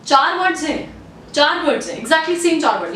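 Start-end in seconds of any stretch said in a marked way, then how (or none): none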